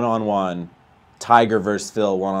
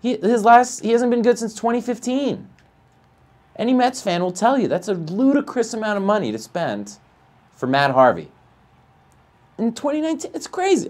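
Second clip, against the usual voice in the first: much higher, incredulous; voice up in disbelief; 10 semitones higher than usual. Real, not acted. Streamer ranting about the Harvey contract, voice high in disbelief. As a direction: let the voice climb in disbelief. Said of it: "my voice is going up 'cause I'm in disbelief".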